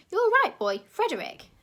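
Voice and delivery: high, loud, fussy posh voice; a plummy official begging for order; posh gentleman. A narrator's reading. Posh zoo director pleading with the crowd, loud and high.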